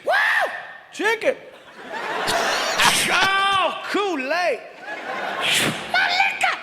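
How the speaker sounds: high, thrilled cries over each item; 1 excited whoops. Retold or imitated. G thrilled unpacking the basket, high excited cries. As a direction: squeal with delight at every gift.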